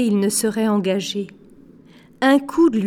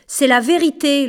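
mild shout, pitched up; insistent, raised voice; calling out; 5 semitones higher, 4 dB louder than usual. Acted. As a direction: call it after them in protest. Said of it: "leur cria Minuit cinq".

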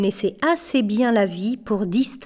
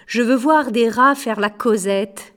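plain reading voice

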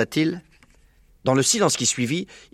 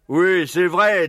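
very slow, higher character voice; agreeing badger; 7 semitones higher, much slower than usual. Blaireau backing up the fox's claim, agreeing. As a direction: draw it out as a badger. Blaireau confirms the tunnel's stores.

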